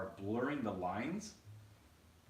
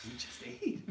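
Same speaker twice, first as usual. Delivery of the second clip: high, voiceless laughing reply; 11 semitones higher, 3 dB quieter than usual. Real, not acted. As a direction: squeeze the words out through a laugh.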